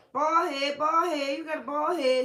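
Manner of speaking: high, loud playground taunt; loud sing-song name-calling; taunting kids. Acted. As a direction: jeer like mocking children. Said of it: "had kids killed for calling him".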